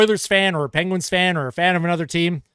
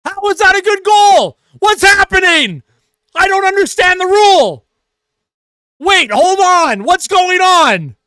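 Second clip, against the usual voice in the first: high, loud outraged yelling; shouting at a bad call; furious shout; 10 semitones higher, 6 dB louder than usual. Real, not acted. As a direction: yell at the screen in disbelief. Furious at goal review call.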